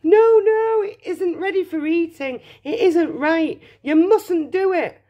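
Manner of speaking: high, panicky Mr Wonka; frantic protest. A narrator's reading. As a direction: plead with rising alarm to stop. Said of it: "wringing his hands and saying".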